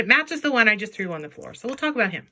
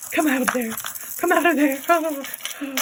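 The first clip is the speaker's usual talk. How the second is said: coaxing; 7 semitones higher than usual. Pretend. Coaxing earring out.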